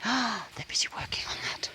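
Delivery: hushed whisper while exploring; quiet sneaking whisper. Real, not acted. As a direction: whisper so no one hears.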